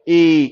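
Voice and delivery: modelling the reading; long e. Shown as a demonstration. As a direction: read the ए long. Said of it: "इसको हम read कैसे करेंगे?".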